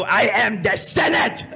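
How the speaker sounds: slow, high, croaking villain shout; the Emperor. Retold or imitated. Drunk prankster revealing himself on phone, hammed-up villain shout. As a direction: proclaim it like an evil overlord. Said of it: "I start imitating the Emperor from Star Wars".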